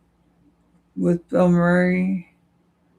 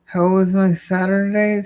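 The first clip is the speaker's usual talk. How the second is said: normal talking voice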